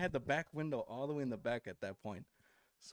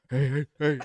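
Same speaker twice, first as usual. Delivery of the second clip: loud startled hey hey hey; scared hey; 10 dB louder than usual. Retold or imitated. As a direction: jolt awake calling out. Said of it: "all scared and shit".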